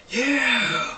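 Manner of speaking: high, loud, drawn-out whoop; celebratory yell; excited cheer. Real, not acted. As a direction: cheer for it, voice shooting up. Cheers about film release tomorrow.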